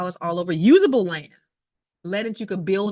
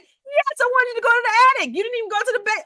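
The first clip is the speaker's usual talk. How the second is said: much higher and louder, fast, in mock exasperation; exasperated yell; 7 dB louder than usual. Telling the inspector he was supposed to check the attic, exasperated high yell. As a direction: fire it back up high in disbelief.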